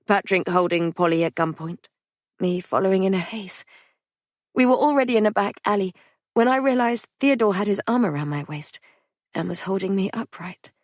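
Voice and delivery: usual speaking voice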